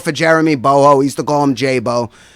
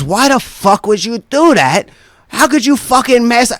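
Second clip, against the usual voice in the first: higher-pitched mock outrage; indignant confrontation; 8 semitones higher than usual. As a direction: imitate an upset friend demanding answers. Friend confronts him about money.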